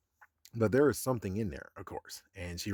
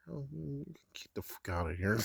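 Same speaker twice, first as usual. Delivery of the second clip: whispered scoff; 3 semitones higher, 7 dB quieter, faster than usual. Retold or imitated. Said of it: "It's like".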